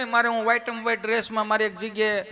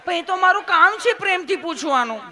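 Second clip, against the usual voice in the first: very high, loud lilting nurse; sweet, loving nurse; 9 semitones higher, 7 dB louder than usual. Acted. Nurse insisting kindness is her job, high, loud and sweet. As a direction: insist lovingly, voice pitched right up. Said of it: "તમે કેટલું પ્રેમથી પૂછો છો".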